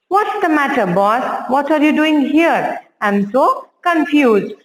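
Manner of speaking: ordinary talk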